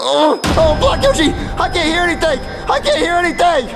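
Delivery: fake-deaf panicked shouting; overplayed alarm, calling for help; mock panic. Pretends to have gone deaf.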